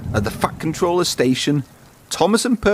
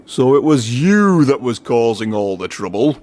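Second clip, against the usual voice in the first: a lightly voiced stern boss catching the culprit; a hint of a stern official; lightly voiced stern controller; Fat Controller.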